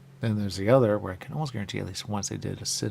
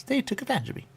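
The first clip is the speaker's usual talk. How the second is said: high mock voice. Voicing a bot's complaint.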